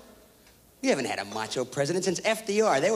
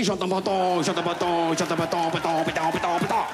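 mock French; slower than usual. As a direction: fake French gibberish in a put-on accent. Gibberish French babble.

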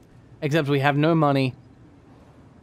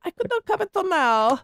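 high pleading voice. Pretend. Voicing game entrant pleading.

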